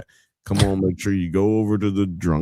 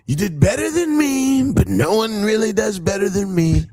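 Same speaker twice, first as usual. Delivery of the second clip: [name] voice. Retold or imitated. Host answering the guest in a high mock [name] voice. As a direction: boast as a put-on rapper, pitched up.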